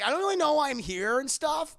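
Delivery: put-on high, loud boyish voice; clueless eager teen. Imitating Peter's Berlin voiceover, high clueless chirp.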